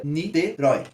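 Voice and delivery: "Niterói" broken into ni, te, rói; syllable by syllable. Shown as a demonstration. Teacher doing syllable separation, syllable by syllable. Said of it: "vamos fazer a separação silábica".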